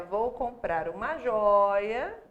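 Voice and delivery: joia drawn out slowly with rising tune; slow demo. Shown as a demonstration. Teacher drawing out the memory phrase for students. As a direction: stretch out joia, open ó, slowly. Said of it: "é só pensar assim".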